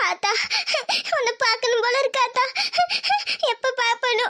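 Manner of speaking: tearful, broken by sobs; weeping between short phrases; sobbing longing. Acted. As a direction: call for mother through sobs. Alone, weeping for her mother.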